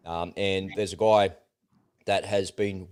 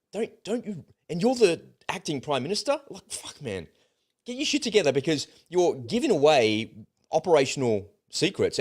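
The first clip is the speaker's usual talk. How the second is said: pitched way up, half-shouting in disbelief; exasperated outburst; 8 semitones higher than usual. Retold or imitated. Host venting at the acting prime minister, exasperated and pitched high. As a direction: vent at the leaker like he's in the room.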